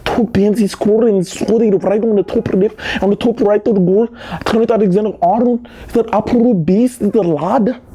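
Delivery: put-on a light Scouse accent on the goal call; playing a Liverpool pundit, Scouse lilt. Football vlogger doing a Liverpool accent for the goal. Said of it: "Tell me if that Liverpool accent was good".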